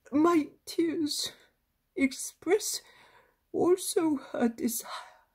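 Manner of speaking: put-on a tearful elderly grandfather asking a favour; old grandfather. A narrator's reading.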